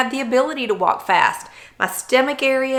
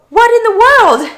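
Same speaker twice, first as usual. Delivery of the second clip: disbelief; 14 semitones higher, 12 dB louder than usual. Retold or imitated. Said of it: "And I said to her".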